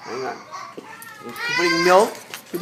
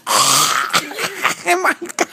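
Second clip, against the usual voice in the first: slow, high breathless laughter; laughing; 11 semitones higher, 4 dB louder, much slower than usual. Real, not acted. Kids jabbing him in play.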